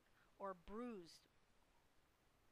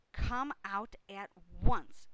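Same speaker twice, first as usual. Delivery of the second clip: loud, slow command; Missus Arable's cry; 16 dB louder, slower than usual. A narrator's reading. Mother ordering son out of crate, loud slow shout. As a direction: shout the order, spacing the words out. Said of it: "cried Missus Arable".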